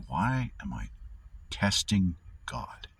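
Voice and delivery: whispered, slowing right down on testing God; hushed realisation. Retold or imitated. Realising he is testing God, a slow whisper. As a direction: breathe it like a secret dawning on you. Sudden realisation, inner thought.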